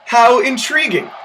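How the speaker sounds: ordinary talk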